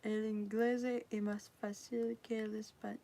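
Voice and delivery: reading the Spanish aloud in a self-conscious, soft English-accented Spanish; a learner haltingly sounding out Spanish in a clumsy accent; bad Spanish accent. Shown as a demonstration. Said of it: "I'm reading with a bad accent".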